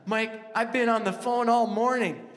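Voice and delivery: high, loud, thrilled yelling; excited shout. Retold or imitated. Wife shouting to husband about winning the trip, thrilled and loud. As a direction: burst out with the big news at full volume. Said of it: "She's like".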